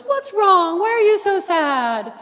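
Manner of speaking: high, worried comforting plea; lightly acted concern for a sad friend; voicing Sophie. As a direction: coach voicing Sophie asking Charlotte why she is sad. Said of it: "she sits down and she goes".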